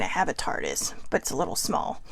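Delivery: hushed, secret whisper; whispered joke. Pretend. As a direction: whisper it like a confession. Joking aside about owning tardis.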